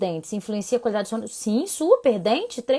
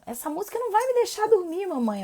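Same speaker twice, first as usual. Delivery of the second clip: little daughter; 10 semitones higher than usual. Retold or imitated. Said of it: "anteontem falou".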